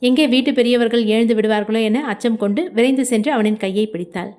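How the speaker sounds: plain reading voice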